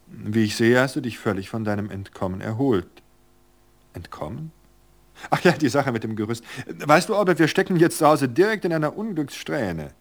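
a sprightly wife brushing off worry with a laugh; cheerful Virgilia. Acted. Virgilia chatting in the club.

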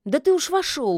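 a touch of testy grumbling. A narrator's reading. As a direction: grumble it in mild irritation. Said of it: "буркнула Яга".

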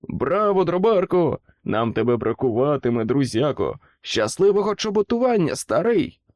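the crowd hailing their departing friend; cheering shouts. A narrator's reading. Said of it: "вигукували вітання".

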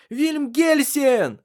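very high, loud, drawn-out call; shouting through house. A narrator's reading. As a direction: call someone from across the house, stretching the name. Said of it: "слышался по всему дому её крик". (громкий протяжный крик на весь дом)

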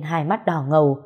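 ordinary delivery

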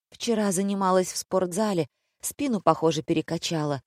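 ordinary delivery

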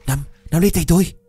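high, loud and fast shout; shouting to a drowning woman to grab his hand; urgent shout. Acted. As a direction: yell it over the rushing water. Rescuer shouting in the river.

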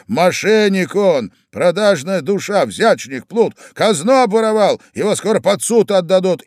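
high, loud string of insults; angry tirade. Acted. Agitated man denounces a rival as a crook, angry tirade. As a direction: spit out each accusation, pitched high.

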